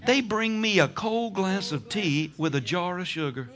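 ordinary talk

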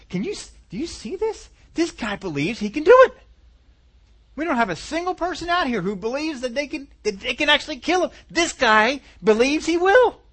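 high, excited disbelief building to loud; incredulous amazement. Acted. Saul tells his war room about David, incredulous.

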